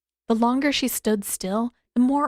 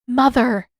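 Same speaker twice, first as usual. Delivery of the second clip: protesting; 4 semitones higher, 7 dB louder than usual. A narrator's reading. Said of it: "Amik protested".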